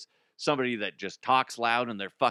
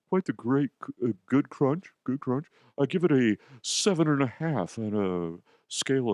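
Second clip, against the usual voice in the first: no-personality reviewer. Acted. Dull applicant Todd reviewing nuggets, stilted personality-free delivery. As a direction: plod through it like a dull reviewer. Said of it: "somebody with no personality".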